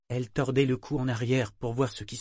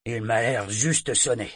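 ordinary delivery, nothing marked

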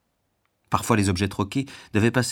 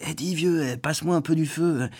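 prisoner calling out; 7 semitones higher than usual. Acted. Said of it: "il vous appelle".

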